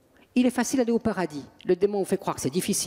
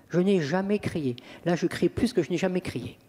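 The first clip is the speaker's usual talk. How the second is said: ordinary talk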